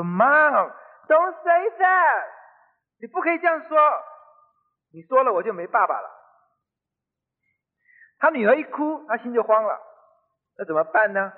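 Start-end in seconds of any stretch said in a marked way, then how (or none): 0.0-6.1 high-pitched imitation of a crying daughter; acted child's distressed plea; tearful pleading. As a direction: plead with mother, mock-wailing then easing off. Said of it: "他女儿就哭了".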